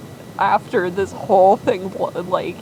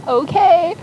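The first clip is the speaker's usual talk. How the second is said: much higher, louder bright okay; chirpy daughter; 12 semitones higher, 6 dB louder than usual. Retold or imitated. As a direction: pop the okay up high.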